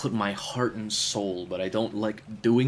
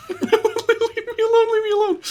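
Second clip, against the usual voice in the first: laughing; 9 dB louder than usual. Real, not acted. Laughing at missing the boat.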